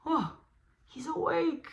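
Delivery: high excited surprise; wide-eyed he's awake; mock alarm for the child; surprised. Bear wakes up.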